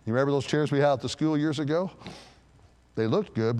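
usual speaking voice

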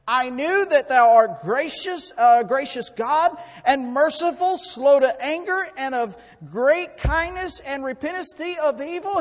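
loud, high, drawn-out protest; indignant complaint. Acted. Prophet complaining to the Lord, indignant. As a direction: hurl the words up as an accusation. Acts out Jonah's complaint to God.

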